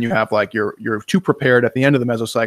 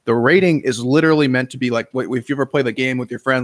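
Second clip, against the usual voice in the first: normal talking voice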